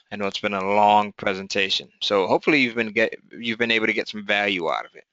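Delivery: ordinary talk, nothing marked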